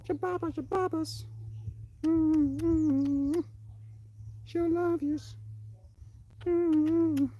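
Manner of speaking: very high squeaky cooing to a pet. Real, not acted. Doting owner to the pet, very high baby talk. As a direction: coo in a tiny falsetto.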